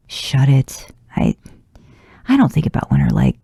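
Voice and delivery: stammering defensively, words tumbling fast; stuttering. A narrator's reading. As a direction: trip over the words like you've been caught out. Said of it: "Dean stutters".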